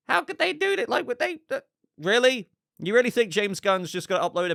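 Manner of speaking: high, distressed mock fan rant; hammed-up high fan outrage; hysterical fan. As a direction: whine it like an outraged fan in disbelief. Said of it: "I saw some people say".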